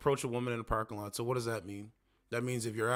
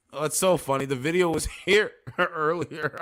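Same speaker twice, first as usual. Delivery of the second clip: amused, louder, breaking into laughter; laughing; 3 semitones higher, 5 dB louder than usual. Real, not acted. Host amused that the video vanished, laughing as he talks. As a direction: talk through a real laugh.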